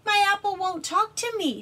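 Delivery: high, loud worried plea; upset little dragon pleading. Acted. Dragon voiced in story.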